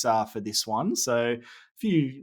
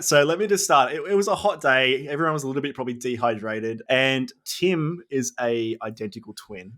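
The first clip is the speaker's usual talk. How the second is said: ordinary delivery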